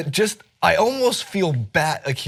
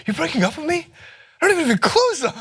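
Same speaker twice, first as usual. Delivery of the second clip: high-pitched whiny breakup protest; hammed-up heartbroken squeal; Jason Segel; faster than usual. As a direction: imitate a dumped American man, voice jumping way up. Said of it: "it sounds like Pete Holmesy, almost like Jason Segel".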